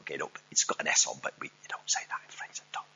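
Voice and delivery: quick, hushed aside; quiet aside. Real, not acted. Preacher explaining a French spelling, quick hushed aside. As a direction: rattle it off under your breath.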